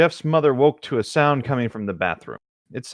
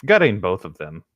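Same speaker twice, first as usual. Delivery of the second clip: ordinary talk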